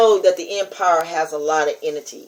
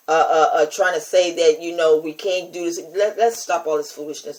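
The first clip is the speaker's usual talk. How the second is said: ordinary delivery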